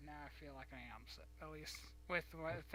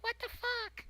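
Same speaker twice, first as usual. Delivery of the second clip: put-on much higher childlike squeak; high squeaky voice; 7 dB louder than usual. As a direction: pitch it way up like a kid. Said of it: "I'm like".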